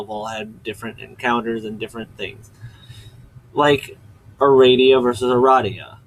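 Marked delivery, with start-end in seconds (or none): 4.4-6.0 Aradia first with "ray", then Aradia with "rah"; contrasting pronunciations. Shown as a demonstration. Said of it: "difference between pronunciations".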